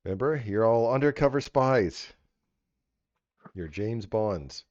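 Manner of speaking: ordinary talk, nothing marked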